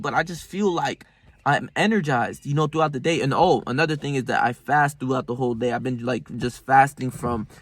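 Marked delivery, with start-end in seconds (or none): none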